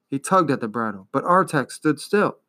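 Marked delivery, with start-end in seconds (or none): none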